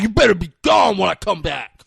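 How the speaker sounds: loud mocking shout; mock tough guy. Mocking Chucky's warning as he leaves, loud fake tough guy. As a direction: parody a tough guy's threat at full volume. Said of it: "showed them who the tough guy was".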